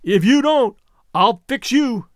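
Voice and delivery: loud threat. A narrator's reading. Fisherman threatening across water.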